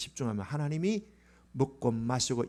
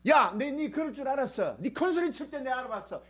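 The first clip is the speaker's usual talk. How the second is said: told-you-so mockery; 10 semitones higher than usual. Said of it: "그랬어요?".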